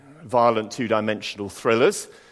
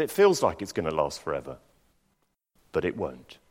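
calm, lower, reassuring voice; said very calmly; 7 semitones lower, 3 dB quieter than usual. Retold or imitated. Doctor calmly reassuring a sick friend, calm. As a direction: soothe him, quietly sure. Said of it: "And he just said very calmly".

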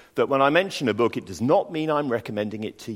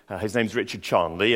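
usual speaking voice

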